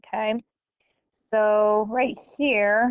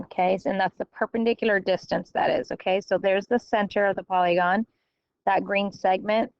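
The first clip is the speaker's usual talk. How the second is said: ordinary talk, nothing marked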